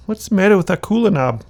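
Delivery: put-on high child's voice; curious child. Village kid pointing, asking in a high child's voice. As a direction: pipe up like a little kid. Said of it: "Kid's pointing at him".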